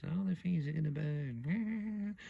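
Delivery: high, slow creepy granny voice; sinister old woman. Retold or imitated. Imitating the horror film's grandma, high and sinister. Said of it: "Proper sinister".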